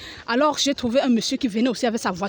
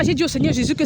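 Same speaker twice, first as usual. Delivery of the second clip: normal talking voice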